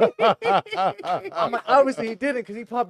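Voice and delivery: high, laughing through words. Real, not acted. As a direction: laugh while you tell it. Laughing while telling it.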